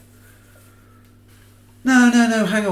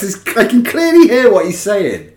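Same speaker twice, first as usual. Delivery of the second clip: ordinary talk, nothing marked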